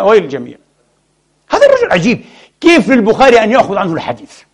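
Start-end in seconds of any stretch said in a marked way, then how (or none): 1.5-4.4 هذا shot up very high in disbelief; astonished exclaim. Acted. Preacher to congregation about Bukhari's sheikh, astonished outburst. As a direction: cry out in amazement.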